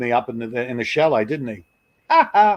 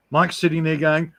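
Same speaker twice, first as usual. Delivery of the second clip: usual speaking voice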